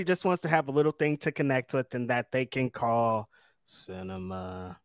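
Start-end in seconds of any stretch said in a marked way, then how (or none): 3.7-4.8 dramatic cinema. Real, not acted. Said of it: "I like you're like, "Cinema".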